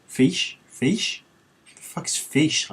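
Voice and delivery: rising confused questions; puzzled, incredulous rereading; baffled. Real, not acted. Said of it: "confused at the word".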